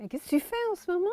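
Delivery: high curious little voice; high-pitched voice. Retold or imitated. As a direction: ask it in a squeaky eager tone. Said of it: "Elle avait une voix un peu aiguë". (petite voix aiguë curieuse)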